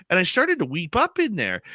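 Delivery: ordinary talk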